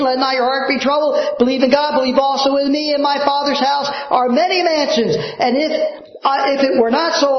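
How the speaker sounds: high, crying-out pulpit climax; impassioned preaching. Acted. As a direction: build to the cry of Heaven.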